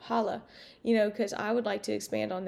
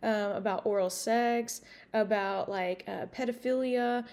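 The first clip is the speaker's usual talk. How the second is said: normal talking voice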